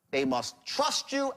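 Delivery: slow, high, stress on "you"; emphatic. Shown as a demonstration. Teaching the rule to salespeople, slow and emphatic. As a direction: draw out "They must trust you" slowly and lift it high.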